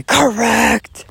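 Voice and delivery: high clipped mock shout; faux yell. Answering critics who call her angry, faux yell. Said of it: "faux yell".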